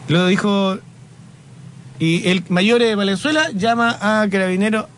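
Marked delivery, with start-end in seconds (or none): none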